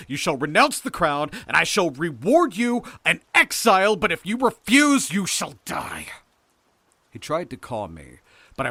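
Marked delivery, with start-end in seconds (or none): 0.1-6.1 loud, high, raging decree; full-voiced furious ultimatum; shouted threat. A narrator's reading. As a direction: rage up to the threat, then drop slightly. Hildred threatens Lewis with death.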